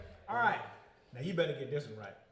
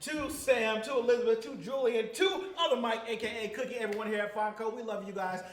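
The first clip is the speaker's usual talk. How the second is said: loud upbeat announcer outro; show host sign-off; 6 semitones higher, 10 dB louder than usual. Acted. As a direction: wrap up the show with big energy.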